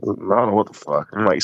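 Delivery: normal talking voice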